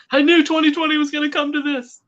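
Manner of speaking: very high-pitched mock wail; mock despair. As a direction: wail it as comic doom. Mock despair over backer civil war.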